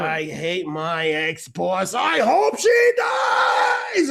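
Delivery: hammed-up venomous curse, rising to a yell; over-enthusiastic hate. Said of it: "with a lot of enthusiasm".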